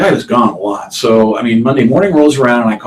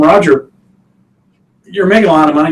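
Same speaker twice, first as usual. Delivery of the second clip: raised, higher voice, lecturing; mock accountant lecture; 7 semitones higher, 6 dB louder than usual. Accountant scolds client Roger over his taxes, voiced raised and high.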